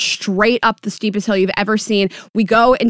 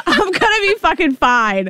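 laughing; 7 semitones higher than usual. Real, not acted. Laughing while telling story.

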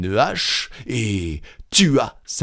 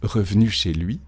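usual speaking voice

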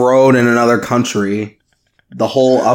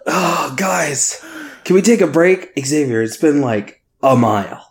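hammed-up, higher-pitched whine; mock complaining; 4 semitones higher than usual. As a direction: play the tired one begging for a break. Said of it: "hear me complain the whole time".

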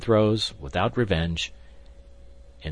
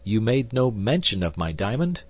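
higher, mildly fearful question; frightened; 5 semitones higher, 4 dB louder than usual. A narrator's reading. Man asking the gambler about his diamond, mildly frightened. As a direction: ask with a touch of dread. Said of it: "half dead with fright".

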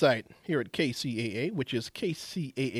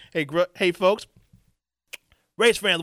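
ordinary talk, nothing marked

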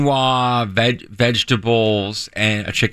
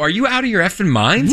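higher-pitched indignant quote; retold outrage; 7 semitones higher than usual. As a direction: act out an incredulous accusation to the wife. Retold quarrel over chicken.

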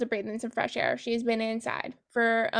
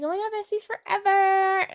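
high, bubbly gush to a best friend; mock-giddy Paris gushing over her bestie; 8 semitones higher than usual. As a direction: squeal it like a giddy teen.

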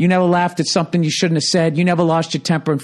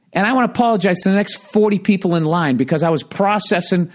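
normal talking voice

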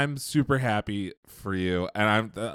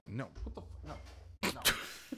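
hushed embarrassed whisper; 16 dB quieter, slower than usual. Real, not acted. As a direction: whisper it off-mic. Embarrassed aside to co-host.

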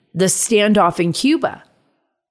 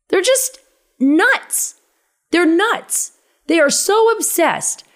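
pitched much higher in disbelief; exasperated disbelief; 9 semitones higher, slower than usual. Real, not acted. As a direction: voice jumps up at how crazy it is. Exasperated rant about opponents.

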